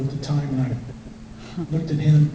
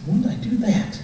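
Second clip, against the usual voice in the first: mock surprise; 8 semitones higher than usual. His retort to wife.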